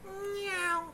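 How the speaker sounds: high, soft imitation meow; mock kitty mew; cat meow. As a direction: answer like a cat, high-pitched. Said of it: "meowing like a cat".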